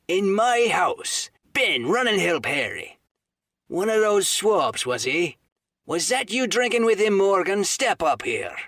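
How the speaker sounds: high, loud indignant outburst; angry landlord. Acted. Silver rounds on Morgan in outrage, loud. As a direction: rage at the insult to his house.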